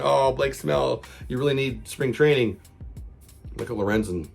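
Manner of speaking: normal talking voice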